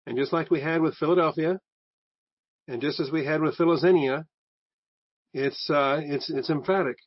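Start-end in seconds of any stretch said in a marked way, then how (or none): none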